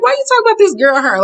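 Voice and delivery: high, fast indignant question; incredulous clapback. Retold or imitated. Vlogger defending the new girl, high indignant. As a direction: fire it off, offended.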